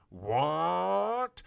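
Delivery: high, loud horrified what; hammed-up shocked Garfield; shocked gasp. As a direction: gasp it in horror. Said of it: "Garfield gasped in shocking horror".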